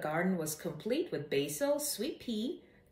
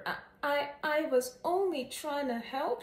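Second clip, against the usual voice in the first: whimpered; 6 semitones higher than usual. A narrator's reading. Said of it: "she whimpered".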